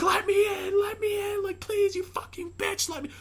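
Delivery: very high shrieking demand to get in; screaming woman. Retold or imitated. Infected woman screaming at the girl inside, shrieking. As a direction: scream and pound to be let in. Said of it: "she's like".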